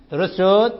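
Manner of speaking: ordinary talk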